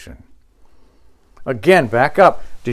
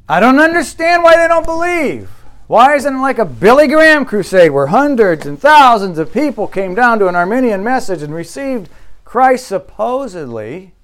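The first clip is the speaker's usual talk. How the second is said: pitched way higher, whining in mock despair; mock panicked whine; 7 semitones higher, 3 dB louder than usual. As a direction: play the fretting believer, voice jumping high.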